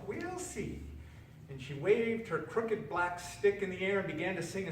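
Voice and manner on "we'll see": very high, softer, laughing inwardly; softer knowing laugh. A narrator's reading. Witch privately amused at the frog, softer and high. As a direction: keep the joke to herself. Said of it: "laughing to herself".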